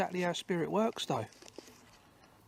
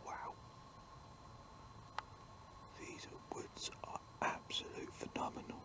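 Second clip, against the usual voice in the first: whispering; 16 dB quieter than usual. Real, not acted. Awed whisper in the woods.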